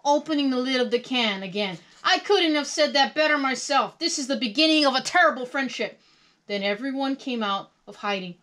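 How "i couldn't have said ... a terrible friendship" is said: loud, raised-pitch grouch voice; Oscar. A narrator's reading. Grouch welcomes a fellow grouch, in grouchy character. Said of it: "said Oscar".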